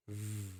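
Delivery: soft buzzing V; voiced v. Shown as a demonstration. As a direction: hold a quiet voiced V. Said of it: "but you use your vocal cords".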